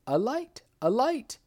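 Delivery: sharp high cry for a light; startled shout for a light. A narrator's reading. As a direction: call out in alarm, high. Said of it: "he cried".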